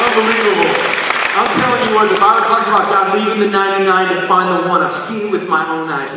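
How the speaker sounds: loud, high, fast preaching; full-force testimony; fervent preaching. Real, not acted. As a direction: drive it home to the crowd.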